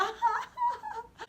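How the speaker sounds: panicked laugh-cry. Retold or imitated. Terrified laugh in killer's car.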